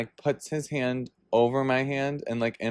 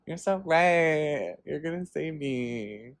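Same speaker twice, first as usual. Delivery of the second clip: mock swooning; 3 semitones higher, slower than usual. Voices her gullible reply to him.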